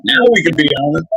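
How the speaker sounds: high, quick laughing quip; a playful laughing tease; giggly mock line. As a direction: toss it off through a giggle.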